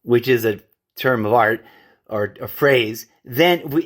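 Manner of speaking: normal talking voice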